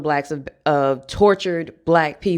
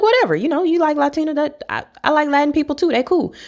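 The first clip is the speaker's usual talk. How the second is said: dismissive self quote; 11 semitones higher, faster than usual. Retold or imitated. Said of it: "And I was like".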